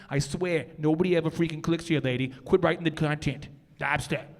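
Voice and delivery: put-on Boston accent; Bostonian accent. Presenter playing a Bostonian telling off a writer, Boston accent. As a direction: scold someone in a mock Bostonian accent. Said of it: "You want a Bostonian?".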